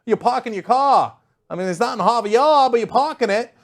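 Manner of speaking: loud, high mock Boston accent. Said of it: "it sounded almost like a Boston accent".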